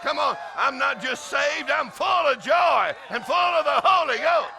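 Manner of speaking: rally them, climbing to a shout; full-force preaching. Acted. Preacher rousing the happiest people in Virginia, full-force preaching.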